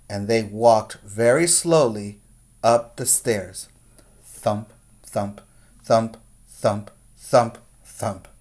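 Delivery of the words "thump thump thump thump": ordinary delivery, nothing marked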